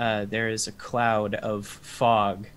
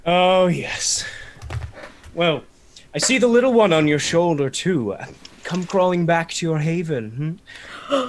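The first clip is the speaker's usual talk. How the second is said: loud, fast, taunting villain; gloating vampire lord; 5 semitones higher, 5 dB louder than usual. Acted. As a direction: sneer it out, pleased with yourself. DM voicing Strahd.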